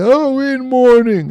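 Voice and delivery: high, slow, mocking mourner's wail. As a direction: parody the helpless mourners, high and drawn out. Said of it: "put your hand on our head and bawl and say".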